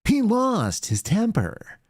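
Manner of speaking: high, slow mock-solemn repeat; mock anchor. Host mocking the news line in a high slow voice. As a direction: drag it out like a snide anchor.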